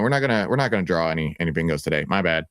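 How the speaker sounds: normal talking voice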